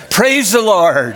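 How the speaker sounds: a thankful cry after a near miss; relieved praise. Acted. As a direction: exclaim it with huge relief. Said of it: "with a tremendous sigh of relief".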